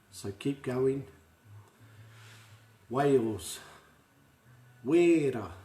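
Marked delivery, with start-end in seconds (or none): 4.8-5.5 "Wera" said loud, weh-ra; Māori pronunciation. Shown as a demonstration. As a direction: model the word clearly for echoing. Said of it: "say it after me".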